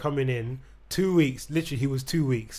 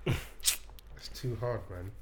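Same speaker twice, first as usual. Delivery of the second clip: exasperated whisper; 4 semitones lower, 9 dB quieter, much slower than usual. Real, not acted. Sighing at hard name.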